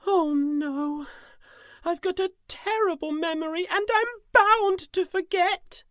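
high, whiny despair; high tiger voice, groaning and despairing. Acted. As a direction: moan about your bad memory. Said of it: "and groaned".

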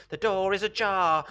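high, loud rooster voice; insistent cockerel. A narrator's reading. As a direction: call it out like a cockerel. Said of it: "said the cockerel".

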